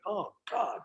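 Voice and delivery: high-pitched, subdued self-doubt; retold sadness. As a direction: replay your hurt, bewildered worrying. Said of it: "I got really sad".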